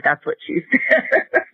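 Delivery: high, slow, laughing delivery; a husband pointing at her, amused; laughing punchline. Retold or imitated. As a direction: let the laugh carry the line.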